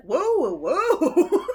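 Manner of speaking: loud, high acted whoas; mock alarm. Listener cutting off an obscene remark, mock alarm.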